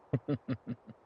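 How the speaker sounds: rippling little vampire laugh; self-amused villain chuckle. Acted. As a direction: chuckle at your own undead joke. Vampire amused by own undead joke.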